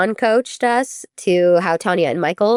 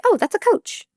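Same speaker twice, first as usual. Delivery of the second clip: much higher, breezy brush-off; dismissive shrug; 14 semitones higher than usual. Retold or imitated. Gymnast raised in toxic gyms shrugging off a coach, breezy. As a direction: wave it off lightly, like it's normal. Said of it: "You're just gonna be like".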